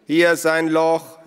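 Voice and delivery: loud, high, slow holler; drawn-out call to people indoors; calling from outside. A narrator's reading. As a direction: shout it from the garden. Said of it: "hörten sie Naru von draußen rufen".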